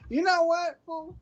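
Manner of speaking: light impression of a tequila-soaked relative scolding a freeloader; drunk party uncle. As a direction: play the tipsy cousin who loves you and scolds you. Acting drunk uncle at family party.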